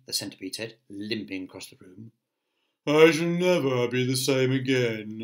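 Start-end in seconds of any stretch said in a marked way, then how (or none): 2.9-5.2 droning, doleful creature voice; murmuring earthworm. A narrator's reading. Earthworm after the rough tumble, doleful murmur. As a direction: moan it along in one long drone. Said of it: "murmured the earthworm".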